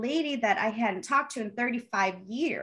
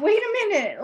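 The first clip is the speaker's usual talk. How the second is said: much higher, louder disbelief; sudden high, loud wait a minute; stunned realization; 11 semitones higher, 5 dB louder than usual. Retold or imitated. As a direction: catch yourself in shock. Realizing the photo was her.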